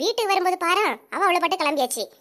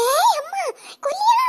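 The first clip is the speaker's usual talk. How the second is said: panicked cry; 12 semitones higher than usual. Acted. Wife fleeing her angry husband, panicked cry. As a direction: shriek as if about to be hit.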